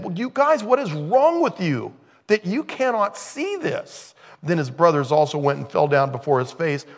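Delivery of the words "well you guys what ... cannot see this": much higher, slow, pleading; exasperated Joseph. Acted. Joseph pleads with his brothers, high and slow. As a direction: plead with them, baffled.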